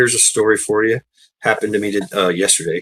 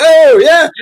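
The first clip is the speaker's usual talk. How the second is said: very high, loud oh yeah; sarcastic Brit; 9 dB louder than usual. Retold or imitated. Brit retorting to the American, very high sarcastic. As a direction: shoot it back sarcastically. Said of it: "He's like".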